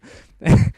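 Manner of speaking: loud burst of amused laughter; real laugh. Real, not acted. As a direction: crack up mid-story. Cracking up at his own story.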